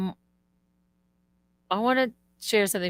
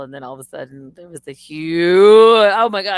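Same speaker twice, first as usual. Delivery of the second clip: normal talking voice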